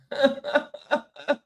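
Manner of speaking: a real high giggle; high laugh. Real, not acted. Feeling tricked by a viewer, real giggle. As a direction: laugh it off, amused.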